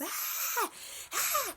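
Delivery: high, loud imitated screaming; mock screams. Retelling how the wife screams at the bug, mock screams. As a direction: scream twice like a terrified wife. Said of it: "She screams at the top of her lungs".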